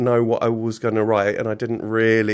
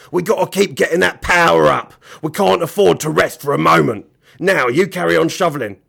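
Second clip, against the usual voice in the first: loud, higher-pitched rough-voiced orders; bossy villain; 6 semitones higher, 7 dB louder than usual. Mister Gum ordering Billy to shovel, loud and bossy. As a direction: push the accomplice back to work.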